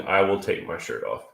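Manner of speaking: ordinary talk, nothing marked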